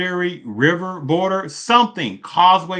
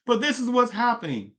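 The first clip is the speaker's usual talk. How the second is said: ordinary talk, nothing marked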